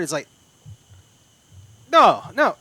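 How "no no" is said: loud, raised-pitch refusal; yelling guard. Acted. Guard rejecting the intruder's bluff, yelling. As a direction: shout it as an alarmed armed guard. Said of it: "begins yelling out in Spanish".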